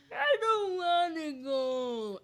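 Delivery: put-on loud, high, slow old-man wail; drawn-out crying old man; Grandpa Simpson. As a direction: whine like a cartoon grandpa. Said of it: "really good Grandpa Simpson".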